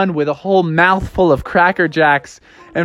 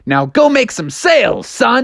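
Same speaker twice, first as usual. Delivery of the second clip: lightly voiced send-off, higher pitched; higher, rousing boss voice; hearty boss; 7 semitones higher than usual. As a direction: send the rookie out with gusto. Boss sends Froggy off.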